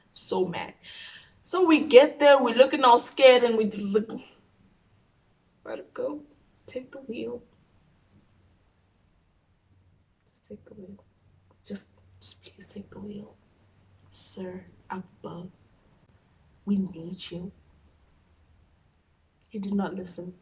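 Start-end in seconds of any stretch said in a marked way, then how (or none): 5.6-17.5 fading to a near-silent whispered plea; desperate prayer. Retold or imitated. Scared teen praying for rescue, whispered. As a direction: beg under your breath, slowing on the name.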